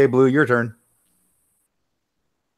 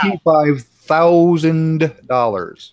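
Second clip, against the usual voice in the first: "thousand dollars" stretched very slowly and pitched up; drawn-out emphasis; 4 semitones higher, 4 dB louder, much slower than usual. Real, not acted. Answering how much it cost, very slow emphatic "thousand". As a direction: draw out "Twenty-five thousand dollars" word by word for effect. Stressing the shocking price.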